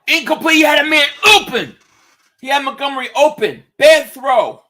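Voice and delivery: loud, high cry over a blown play; real exasperated sports call; exasperated play-by-play shout. Real, not acted. Reacting live to incomplete pass.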